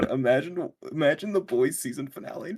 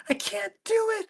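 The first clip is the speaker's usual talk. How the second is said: squeaky high strained "I can't do it", much higher; mock Kimiko straining. Friend mocks a hero failing to power up, high strained whine. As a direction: play a struggling heroine, high and strained. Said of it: "she's like".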